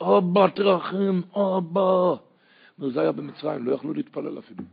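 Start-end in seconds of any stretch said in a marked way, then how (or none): 0.0-2.1 slow, drawn-out cry for mercy; pleading cry. Acted. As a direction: call out helplessly to a father, higher and stretched. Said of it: "הוא צועק". (זעקת תחנונים איטית)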